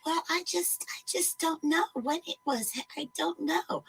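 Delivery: high, whispered, mousy stammer; mock the timid receptionist in a tiny scared voice; meek stammering receptionist. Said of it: "meek and mousy and just sort of mamby-pamby and kind of stammering".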